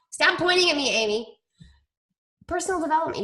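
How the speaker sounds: normal talking voice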